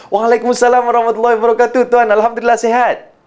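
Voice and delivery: quick, high, cheery reply; match a fast talker's rapid, high delivery; mirrored fast speech. Shown as a demonstration. Replying to the greeting, mirroring the fast speed. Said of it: "kita pun match and mirrorkan".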